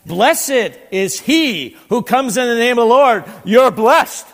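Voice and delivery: loud, joyful crowd acclaim; crowd shouting blessing. Retold or imitated. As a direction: hail him like the Palm Sunday crowd. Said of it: "we're shouting".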